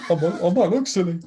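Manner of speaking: high-pitched teasing imitation; mock worried voice. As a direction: tease in a put-on high voice. Mocking the fear of embarrassment.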